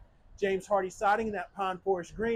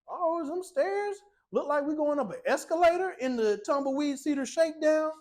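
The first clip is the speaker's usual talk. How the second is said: mock surprise; 7 semitones higher than usual. Reveals wide loft stairs.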